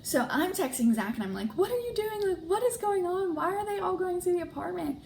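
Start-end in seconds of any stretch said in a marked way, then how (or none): 1.6-5.0 much higher, frantic questioning; panicked demand. Retold or imitated. Texting her boyfriend about the team meeting, frantic. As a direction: fire the questions in alarm.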